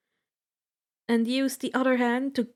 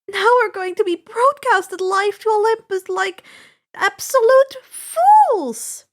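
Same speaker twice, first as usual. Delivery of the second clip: frantic self-blame; 10 semitones higher, 9 dB louder than usual. Acted. Annabeth cursing herself for walking into the trap, loud frantic rant. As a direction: berate yourself at the top of your voice. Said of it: "Annabeth screamed".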